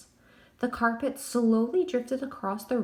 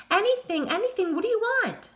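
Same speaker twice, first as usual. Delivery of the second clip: much higher, louder frantic plea; desperate; 13 semitones higher, 5 dB louder than usual. A narrator's reading. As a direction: grovel to the ghost. Crane desperately begging.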